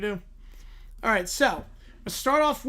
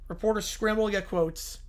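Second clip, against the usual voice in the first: ordinary talk, nothing marked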